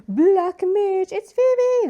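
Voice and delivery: very high, loud excited cry; gleeful fan squeal. Pretend. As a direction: squeal it with over-the-top delight. Fan exclaims over favourite character.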